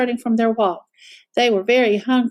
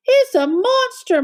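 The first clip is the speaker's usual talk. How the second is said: slow, much higher moan; moaning Mama Bear; 12 semitones higher than usual. A narrator's reading. Mama Bear sees the stranger, high moan. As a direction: draw out the fearful moan. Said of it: "moaned Mama Bear".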